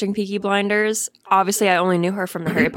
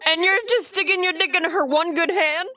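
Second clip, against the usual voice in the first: incredulous; 8 semitones higher than usual. Real, not acted. Incredulous about the scene.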